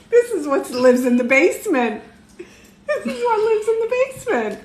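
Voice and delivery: laughing through the words, pitch climbing; can barely get it out for laughing. Real, not acted. Mom presenting her basement-dwelling daughter to viewers, laughing.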